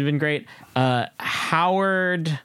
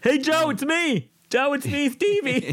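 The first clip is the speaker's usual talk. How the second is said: much higher, eager little sidekick voice; Steve Little's zookeeper; 13 semitones higher than usual. Retold or imitated. Podcaster mimics the zookeeper greeting Joe, in a high eager voice. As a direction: pipe up high like a starstruck zookeeper calling his boss. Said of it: "you have Steve Little playing, like, the head zookeeper".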